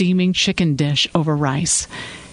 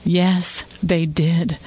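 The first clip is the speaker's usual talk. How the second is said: plain reading voice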